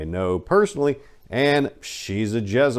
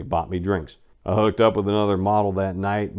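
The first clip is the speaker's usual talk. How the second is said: ordinary delivery, nothing marked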